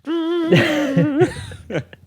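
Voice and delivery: high held note imitating a tune; mock a drunk droning a theme tune; droned theme tune. Drunk soap actor at the bar droning the theme tune. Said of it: "Singing the theme tune".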